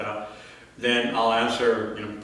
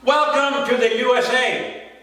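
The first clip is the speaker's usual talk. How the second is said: high, ringing poetic proclamation; proclaimed welcome; 10 semitones higher, 4 dB louder than usual. Acted. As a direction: announce the welcome in a raised pitch. Poem line repeated in contrast.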